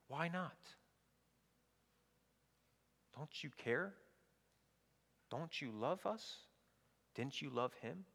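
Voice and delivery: softly pleading reproach; hushed, wounded questions, lightly acted; hurt accusation. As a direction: ask it quietly, as if let down. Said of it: "Do you hear the implicit accusation?".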